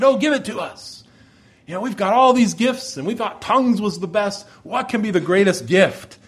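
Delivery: high, excited crowd begging to know; eager Corinthians. Acted. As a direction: pitch up high with impatient eagerness. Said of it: "the Corinthians are on the edge of their seat".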